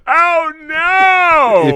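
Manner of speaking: long, high, loud wail; hammed-up cry of dawning dismay; mock horror. Prankster learns he waved at strangers, mock-horrified wail.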